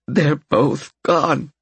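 slow, choked words of grief. Acted. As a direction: let the grief catch in the throat. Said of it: "Alberette choked".

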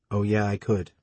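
usual speaking voice

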